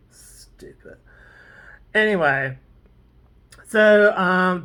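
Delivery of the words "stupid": barely voiced, whispered mutter; whispered aside. Real, not acted. Vlogger scoffing to self about the story, whispered. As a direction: mutter it under your breath.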